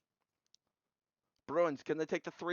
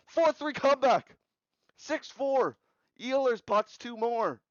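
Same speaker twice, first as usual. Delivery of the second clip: raised, higher-pitched hockey score call; excited comeback call; 6 semitones higher, 3 dB louder, slower than usual. Real, not acted. Calling a comeback as it unfolds.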